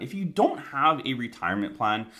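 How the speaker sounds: ordinary delivery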